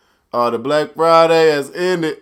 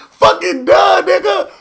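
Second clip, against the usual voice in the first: much higher and louder, shouted through laughter; laughing shout; 7 dB louder than usual. Real, not acted. Vlogger tells viewers the boxer was finished, laughing shout. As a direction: holler it while still cracking up.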